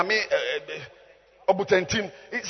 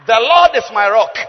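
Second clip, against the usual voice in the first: loud, emphatic proclamation; declaimed verse; 5 semitones higher, 15 dB louder than usual. Real, not acted. Preacher declaring the psalm to the congregation, loud declamation. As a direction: proclaim it forcefully to the room.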